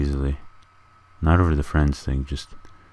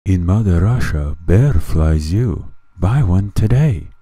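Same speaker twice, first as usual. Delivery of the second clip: mock ad announcer; 3 semitones higher, 6 dB louder than usual. Mock commercial bit.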